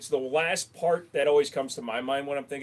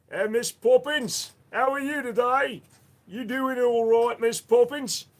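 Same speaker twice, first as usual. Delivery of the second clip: hammed-up high, loud mock Cockney chimney sweep accent; 10 semitones higher, 8 dB louder than usual. Chimney sweep greeting Miss Poppins, hammed-up Cockney accent.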